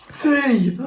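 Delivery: sudden high-pitched cry of alarm; startled cry. A narrator's reading. Sudden fright in the story.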